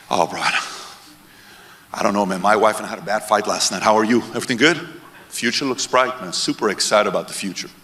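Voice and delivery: low, soft, unbothered reply; lightly voiced laid-back guy who isn't worried; calm long-term thinker. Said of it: "you'll walk around the room like this".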